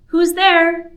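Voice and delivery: high, loud woman's voice calling out; female character voice. Acted. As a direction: call out as the woman behind the door. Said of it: "a different voice for the female character".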